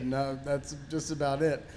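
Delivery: usual speaking voice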